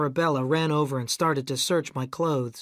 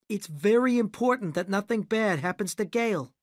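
higher stern instructions; stern director; 6 semitones higher than usual. A narrator's reading. Rick sending the narrator back to LA. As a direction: send him away firmly.

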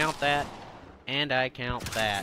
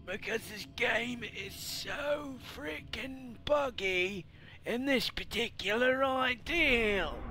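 much higher, slow, strained croaky voice; strained throat; 9 semitones higher than usual. Real, not acted. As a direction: force words through a sore throat. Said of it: "my throat hurts terribly".

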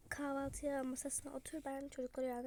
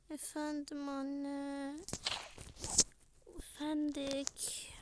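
put-on sleepy child voice; 2 semitones higher, 3 dB louder, much slower than usual. Child answering mother.

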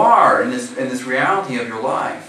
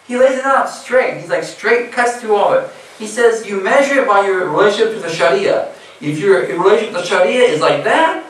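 higher, louder preaching build; impassioned preaching; 6 semitones higher, 5 dB louder than usual. Acted. Preacher rousing young men about the Sharia, impassioned. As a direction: press the point home like a pulpit sermon.

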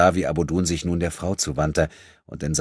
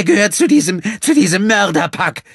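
high, loud furious cry; hateful outcry; 10 dB louder than usual. Acted. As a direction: spit out the hate at them. Woman rages at the attackers. (wütender, schriller Aufschrei)

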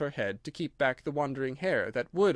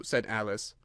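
ordinary delivery, nothing marked

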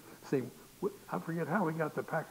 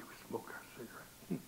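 soft, secretive whisper; furtive whisper; 3 semitones lower, 12 dB quieter than usual. Retold or imitated. Boys slipping out to be somebody, furtively whispered. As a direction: confide it under your breath.

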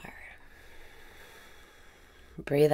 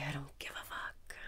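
a much softer whispered release; hushed whisper; 11 dB quieter than usual. Acted. Meditation guide whispering a cheeky release on the out-breath, soft whisper. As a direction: let it slip out as a breathy whisper.

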